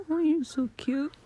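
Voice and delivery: ordinary delivery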